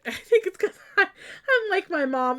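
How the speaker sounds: high, loud words broken by laughter; laughing. Real, not acted. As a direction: laugh through it, slowed down. Laughing at her own excitement.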